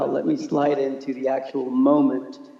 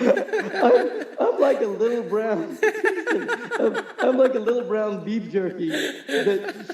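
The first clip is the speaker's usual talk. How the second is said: laughing; 6 semitones higher than usual. Real, not acted. Laughing at own shark joke.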